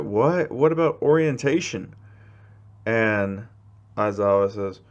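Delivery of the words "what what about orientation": higher-pitched startled question; startled protest. Acted. Students questioning the teacher's sudden test, startled.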